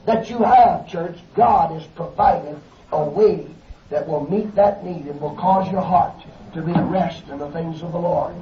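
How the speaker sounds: ordinary delivery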